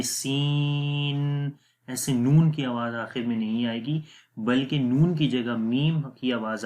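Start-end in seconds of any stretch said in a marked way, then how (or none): 0.0-1.5 سین drawn out slowly, ending in a nasal meem; chanted tajweed; recited with ghunna. Shown as a demonstration. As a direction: stretch سین, no full noon. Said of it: "پوری آواز نہیں پڑھنی".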